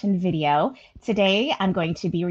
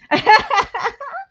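giddy laugh; 13 semitones higher, 6 dB louder than usual. Real, not acted. Laughing after denying emotion.

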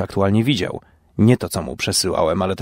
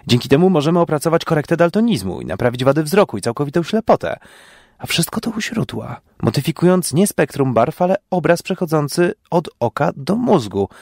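quick, climbing higher with enthusiasm; rising excitement; 7 semitones higher than usual. Acted. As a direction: race ahead, carried away by the idea. Scientist excitedly explains theory. (coraz szybciej i wyżej, w zapale naukowca)

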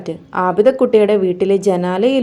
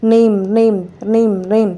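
imitated thread-phone ring. A narrator's reading. Thread phone rings for the kite, imitated ringing. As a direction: mimic a phone ringing twice over. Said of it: "നൂൽഫോൺ അടിച്ചു".

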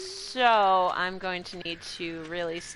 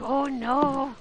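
higher, mock-helpless coo; acted gushing; 6 semitones higher than usual. Streamer acting out meeting a baby animal, melting. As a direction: melt over something cute, pitched up. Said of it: "If you wanna see me make ridiculous faces, show me a baby animal".

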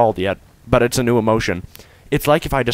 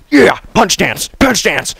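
ordinary delivery

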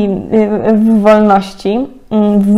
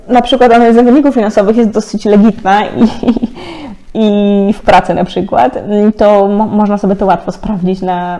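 ordinary talk

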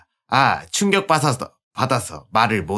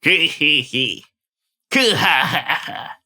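slow evil cackle; 4 semitones higher, much slower than usual. Acted. Villain cackle.

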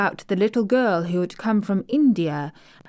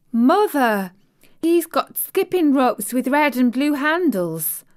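put-on excited little girl calling, higher and louder; child calling out; 7 semitones higher, 5 dB louder than usual. Little sister calling to mother about skipping ropes, excited higher call. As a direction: shout it to mother across the yard. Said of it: "our Elizabeth Ellen called out".

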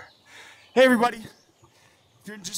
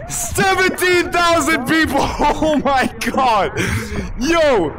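excited shouting; 5 semitones higher, slower than usual. Real, not acted. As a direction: yell it in disbelief. Reacting to viewer count.

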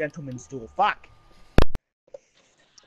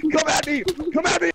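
taunting; 9 semitones higher than usual. Real, not acted. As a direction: dare them to attack. Taunting an attacker, laughing.

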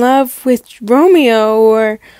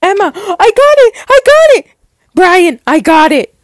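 high, loud squeals of triumph; ecstatic shout; 8 semitones higher, 6 dB louder, faster than usual. Acted. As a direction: shout the good news to friends. Schoolmates celebrate getting parts.

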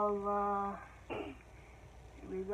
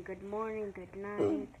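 ordinary talk, nothing marked

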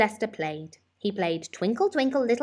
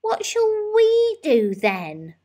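put-on very high, slow child's voice; whiny child; 7 dB louder, slower than usual. A narrator's reading. Laura asking her mum what to do, high and whiny. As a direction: draw it out like a bored little girl.

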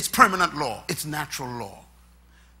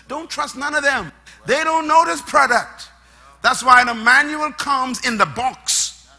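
rising, louder pulpit drive; full-force preaching; 6 semitones higher, 8 dB louder than usual. Acted. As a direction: build it up for the congregation.

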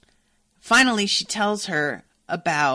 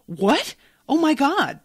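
louder, much higher shocked outburst; startled; 10 semitones higher, 6 dB louder, slower than usual. Acted. Student hears she missed a tutorial, startled. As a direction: jump in with acted alarm.

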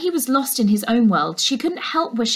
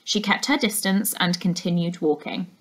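plain reading voice

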